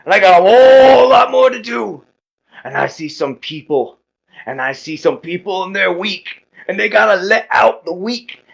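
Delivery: loud, high, bellowed wrestler promo; big showboating strongman voice; boastful muscle-man hype. Acted. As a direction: hype up the crowd like a pumped-up wrestler. Game master plays boastful hype wrestler character.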